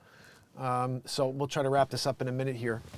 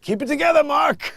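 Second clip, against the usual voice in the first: a higher, lightly voiced Tim telling Mark to hold it together; mock stern Tim voice, raised pitch; imitating Tim; 11 semitones higher, 6 dB louder than usual. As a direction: lightly mimic a friend scolding. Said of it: "and Tim goes".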